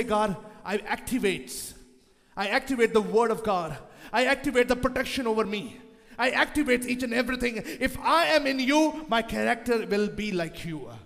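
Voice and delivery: slow, steady declaring; prayer declaration. Acted. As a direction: declare each line firmly. Leads a prayer of activation.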